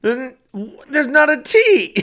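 high, drawn-out disbelief; incredulous protest. Real, not acted. Co-host objecting to town's pronunciation, incredulous.

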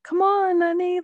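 high, playful mock Butch voice; chirpy impression of a tough guy coaxing; imitating Bruce Willis. Friend retells Pulp Fiction, hurrying his girlfriend, mock high voice. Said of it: "and he was like".

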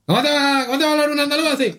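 high, fast mock Andalusian; exaggerated Andalusian accent, high and quick. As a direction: rattle it off like a sped-up Andalusian. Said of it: "ponte a entender un andaluz".

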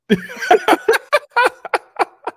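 loud, high, prolonged cackling; real laugh. Real, not acted. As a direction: crack up helplessly at the joke. Cracks up at the pun.

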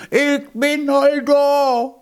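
very high, drawn-out triumphant cry; shouted Low German. A narrator's reading. Hedgehog's wife pops up at the finish, crying out. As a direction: cry it out slowly, high-pitched. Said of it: "auf und schrie".

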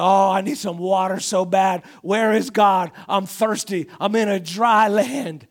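loud, whiny parched complaint, dragging at the end; hammed-up thirsty Israelite moaning for water; mock thirsty complaining. Preacher mocking the complaining Israelites, loud whiny moan. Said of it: "and I'm like".

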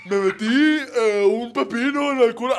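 loud high swishy voice, slow drawn start; camp imitation. Friend mocking him in an exaggerated camp voice. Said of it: "y Ray de repente".

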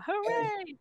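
slow, high, small hooray; mock cheering. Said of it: "everyone's happy and cheering".

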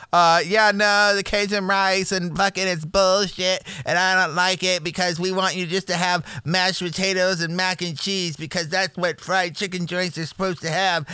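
whiny mock Karen, sneering at the Cajun rice; mock Karen complaint. Mocking a Karen who hates the Cajun rice, whiny complaint. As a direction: ham up a petty customer complaining. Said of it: "two fucking Karens who are like".

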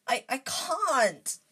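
slow, high, halting protest; high, drawn-out anguish; desperate. A narrator's reading. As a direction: stammer then wail it helplessly. Harry cornered, pleading with Dobby.

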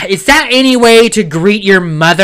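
mock scolding mom. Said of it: "She goes".

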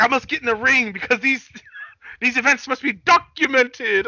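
high, theatrical put-on voice; hammy character declaration; grandiose wrestler impression.

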